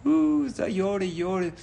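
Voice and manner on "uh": high, drawn-out mock "uh" sob; imitated crying wail. Storyteller imitating a woman weeping in the woods, mock wail.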